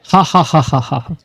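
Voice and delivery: showing the room how to laugh, fading out; हँसकर दिखाना; demo laugh. Shown as a demonstration. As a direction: lead a laugh that trails away. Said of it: "हंसी आ रही तो हंसो जोर से".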